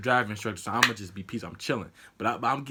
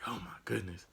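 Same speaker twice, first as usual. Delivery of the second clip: soft, nervous aside; nervous muttering; 3 semitones lower, 12 dB quieter than usual. Retold or imitated. Driver to himself during the test, nervous and hushed. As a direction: mutter it low under the breath. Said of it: "I'm getting nervous".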